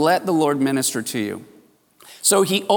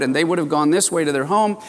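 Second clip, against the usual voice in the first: ordinary delivery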